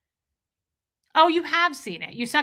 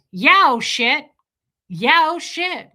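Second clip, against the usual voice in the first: friend's loud reply; 5 semitones higher, 8 dB louder, slower than usual. Retold or imitated. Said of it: "And she goes".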